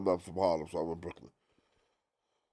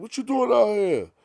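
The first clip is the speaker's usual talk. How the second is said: surprised greeting; 7 dB louder than usual. Retold or imitated. Big surprised, retold.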